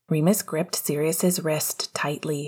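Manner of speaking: ordinary delivery, nothing marked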